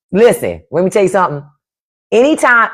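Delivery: ordinary talk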